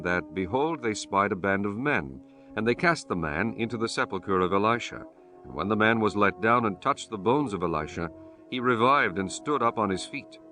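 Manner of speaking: ordinary talk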